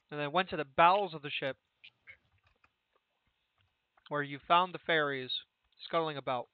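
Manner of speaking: talking between bites; eating chicken. Real, not acted. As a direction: mouth full of chicken. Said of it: "sorry, I'm eating chicken".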